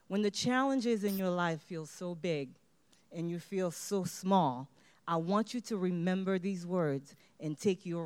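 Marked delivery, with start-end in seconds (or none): none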